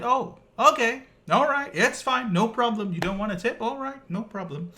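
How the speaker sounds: high, loud mock-offended "fine then"; a driver pretending not to mind, high and loud; sarcastic huff.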